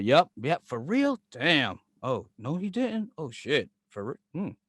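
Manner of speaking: soft, low token reactions; feigned interest. Shown as a demonstration. Showing a friend his mastered trick, feigned interest. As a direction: murmur along as if you care. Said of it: "I've mastered that".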